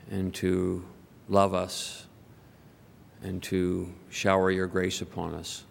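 low, measured praying voice; prayer. Real, not acted. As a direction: pray for the congregation with long pauses. Leads the congregation in prayer.

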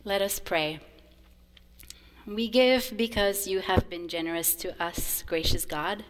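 ordinary delivery

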